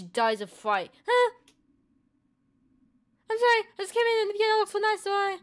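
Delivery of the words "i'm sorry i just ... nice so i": put-on much higher, quick flustered girl voice. Girl in the hotel explaining herself to David, high and flustered. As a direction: squeak it out fast like a nervous girl.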